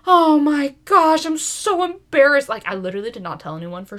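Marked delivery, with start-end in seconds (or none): 0.1-2.5 put-on embarrassed kid. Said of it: "I was like".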